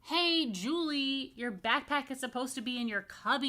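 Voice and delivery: put-on yelling boy. Michael yelling at Julie about her backpack. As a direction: call her out, annoyed. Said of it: "yelled Michael".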